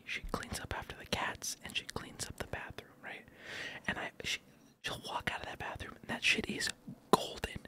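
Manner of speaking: hushed whisper so nobody outside hears; whisper, keeping it secret; whispering while hiding. Real, not acted. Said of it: "I'm talking quiet 'cause I can hear 'em".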